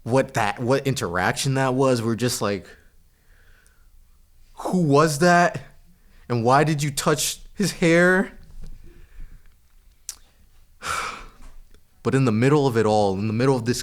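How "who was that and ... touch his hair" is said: slow, raised, incredulous questioning; baffled reaction. Retold or imitated. Kids react to the hair-grabber, mock slow bafflement. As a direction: act out drawn-out bafflement.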